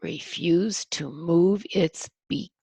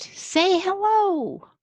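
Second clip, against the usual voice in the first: high, slow bat squeak; squeaky bat call; 13 semitones higher, 6 dB louder, slower than usual. Acted. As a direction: stretch it out high like a tiny bat. Bat calls out.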